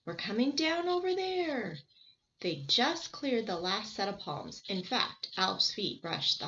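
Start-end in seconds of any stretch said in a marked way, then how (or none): 0.1-1.8 shouting. Acted. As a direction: call out to the others as they land. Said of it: "he shouted".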